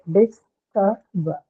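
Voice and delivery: "This, ka, ba" in separate syllables with pauses. Shown as a demonstration. Teacher locating the stressed syllable, "This, ka, ba" said piece by piece. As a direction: split discover into "This", "ka", "ba". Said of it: "next syllable, which is the, uh, ka".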